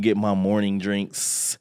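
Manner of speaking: ordinary talk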